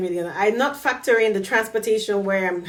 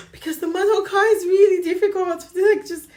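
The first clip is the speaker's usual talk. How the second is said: high, loud, laughing exasperation; laughing, high-pitched protest; laughing complaint; 11 semitones higher, 4 dB louder than usual. Real, not acted. Laughing over driving frustration.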